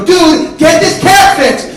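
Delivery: high, slow, forceful yell; exasperated slow bellow. Acted. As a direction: stretch the demand out at full volume. Second, louder demand in escalating quarrel.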